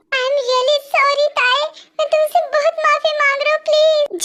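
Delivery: high, pleading as if crying; tearful apology. Acted. Man begging his bride for forgiveness, tearful. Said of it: "Jungkook, तुम रो रहे हो?".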